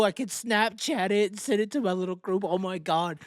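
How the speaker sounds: mock the fans dreaming of a selfie; mock starstruck fan. Mocking fans' thoughts.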